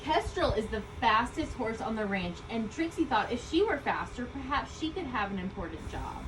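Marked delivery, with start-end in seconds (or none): none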